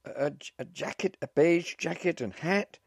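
high, stammering start building to a shout; shouting back. A narrator's reading. Flustered wife describing her husband over the hubbub, shouted. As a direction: shout it over a noisy crowd. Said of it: "she shouted back over all the hubbub".

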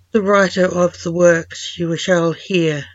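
ordinary delivery

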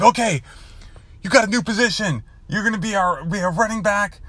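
imitating a fired-up coach, very high-pitched; excited coach going off. Coach giving Brogan a new position, going off excitedly. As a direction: announce it like a coach bursting with excitement. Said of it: "jumping up and down and going off".

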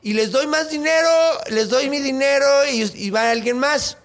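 high, pleading king begging for his daughter; a hammed-up father begging. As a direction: beg the village in a high, desperate voice. King begs to spare his daughter.

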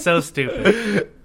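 drawn out, laughing slowly. Real, not acted. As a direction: stretch it out while laughing. Laughing at her own story.

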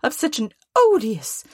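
very high, scolding wife; high, exasperated wifely tirade; indignant Mrs Cratchit. Acted. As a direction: rail about him in a high-pitched voice. Voices Mrs Cratchit railing at Scrooge.

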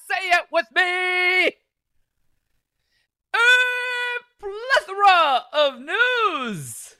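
high, slow drawn-out shout; announcer shout. Shown as a demonstration. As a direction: belt it out like a ring announcer. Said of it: "I'm gonna try and Excalibur this thing".

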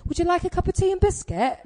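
high, real posh English accent. Shown as a demonstration. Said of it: "to do an English accent".

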